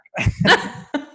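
high, real laugh at his own punchline; burst of laughter. Real, not acted. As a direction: let a real laugh break out.

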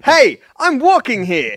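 put-on slow New York tough-guy accent. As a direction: bark it like a New York cabbie.

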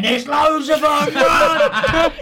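high loud "run!" shout; mock alarm shout. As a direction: yell a fake warning to make him bolt. Retold fake alarm shout.

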